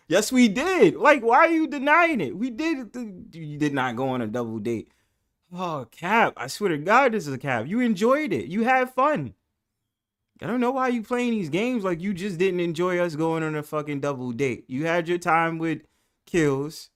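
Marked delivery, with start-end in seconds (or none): 0.1-11.8 teasing insistence. Acted. Teasing argument about double date.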